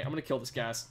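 normal talking voice

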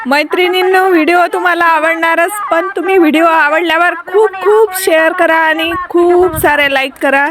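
loud, energetic reporter's sign-off; news report. Acted. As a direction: project it like a field reporter.

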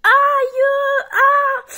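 sharp high yelps of pain; loud acted pain cries; pained cry. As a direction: cry out as you hit the floor. Girl falls down the stairs.

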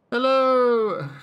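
high, loud, drawn-out British hello; British accent. Shown as a demonstration. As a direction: call out a mock British greeting. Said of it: "Oh, that's more British".

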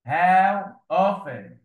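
dictating How often slowly to pupils; slow dictation. Shown as a demonstration. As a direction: stretch every sound of How often for the class.

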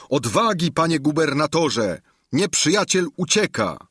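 rally the fallen governor; excited soldier. A narrator's reading. Soldiers shouting to Sancho. (żołnierz woła z zapałem)

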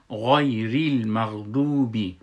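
غیر المغضوبی slow, each syllable articulated; careful tajwid reading. Shown as a demonstration. Teacher demonstrates the phrase, غیر المغضوبی slow and measured. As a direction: sound out غیر المغضوبی with a long "doo". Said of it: "غیر خواندم".

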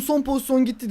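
ordinary delivery

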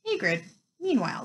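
usual speaking voice

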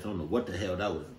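ordinary delivery, nothing marked

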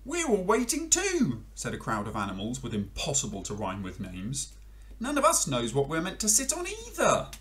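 high, whiny chorus of animals; a crowd grumbling together. Acted. Animals complain to frog, high and whiny.

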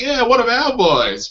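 high-pitched hometown cheer; imitated local pride. Austin crowd welcoming one of their own, high mock cheer. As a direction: cheer him on as a proud local. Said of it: "They're like".

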